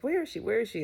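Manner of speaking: put-on high, eager little-kid voice; a hint of an excited child; imitating eager child. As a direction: mimic the toddler asking impatiently. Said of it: "she's excited that the babysitter is coming".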